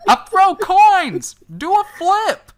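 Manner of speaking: high, loud heckler yell; shouting. Acted. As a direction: bellow it from the crowd. Crowd heckler calls out.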